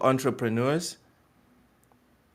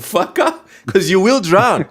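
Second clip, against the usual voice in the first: raised, high, forceful warning; loud and emphatic; 7 semitones higher, 8 dB louder than usual. Real, not acted. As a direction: hammer the warning home. Emphatic advice on failing businesses.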